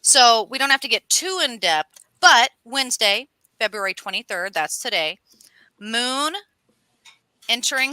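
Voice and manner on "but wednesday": ordinary talk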